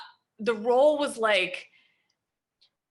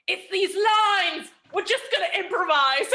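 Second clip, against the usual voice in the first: Tim Roth declaring; 8 semitones higher, 6 dB louder than usual. Retold or imitated. Star actor tells the young actress they will improvise, loud and grand. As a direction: sweep the script aside with a flourish. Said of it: "he goes".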